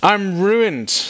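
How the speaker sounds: high cry of ruin; Isaiah in despair. Acted. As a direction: pitch it up in sudden dread. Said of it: "I cried".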